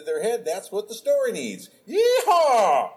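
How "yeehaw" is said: loud high cowboy whoop. Acted. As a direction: holler it like a rodeo cowboy. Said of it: "I'll suddenly be talking like a cowboy".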